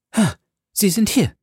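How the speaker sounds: high-pitched exclamation at his arrival; exclaiming. A narrator's reading. Milady greets the awaited Felton, exclaiming.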